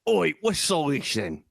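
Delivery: hammed-up British challenge; mock British accent. Trading British catchphrases with his co-host, mock British accent.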